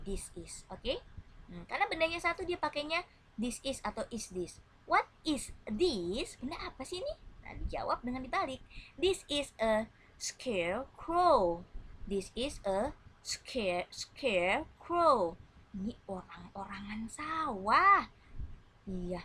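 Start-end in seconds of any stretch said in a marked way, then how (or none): 4.9-6.4 slow, rising, higher model question. Shown as a demonstration. Teacher reading a question aloud for pupils to echo, slow. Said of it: "You have to repeat after me". 9.0-15.4 slow answer, scarecrow louder; slow model answer. Shown as a demonstration. Teacher reading the answer for pupils to repeat, slow. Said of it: "You have to repeat after me".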